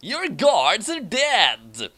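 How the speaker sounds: slow, high, triumphant taunt; gloating villain. Acted. Ron Paul taunting Schneisel, slow high gloat. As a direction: savor telling him it's over.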